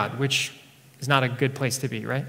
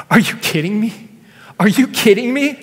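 higher, louder, choked-up disbelief; tearful friend stunned by the news in church, higher and louder; 6 semitones higher, 5 dB louder than usual. Retold or imitated. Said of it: "he's like".

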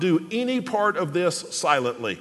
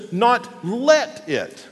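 slow, separated words with let punched; pause, then hit let high; deliberate, emphatic let; stress on LET; 5 semitones higher, much slower than usual. Shown as a demonstration. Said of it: "the next word is the word "let".

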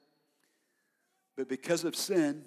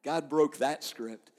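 ordinary talk, nothing marked

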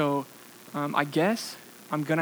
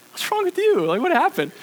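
much higher, startled question; alarmed surprise; 13 semitones higher, 4 dB louder than usual. Retold or imitated. Speaker to his sobbing date, alarmed surprise. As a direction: caught off guard, asking what happened.

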